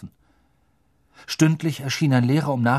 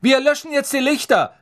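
much higher, louder prefect announcement; calling out; 6 dB louder than usual. Acted. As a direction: proclaim lights out to the hall. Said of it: "rief Percy".

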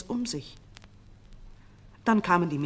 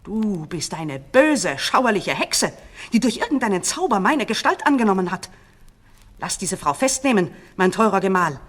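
angry demand; 2 semitones higher, 9 dB louder than usual. A narrator's reading. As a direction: denounce her as a witch. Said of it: "forderte sie".

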